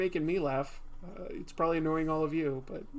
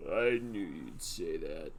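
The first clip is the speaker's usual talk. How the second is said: much lower, slow tough line; mock deep-voiced hero; deep voice attempt; 10 semitones lower, 3 dB quieter, slower than usual. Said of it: "Can't get my voice deep enough".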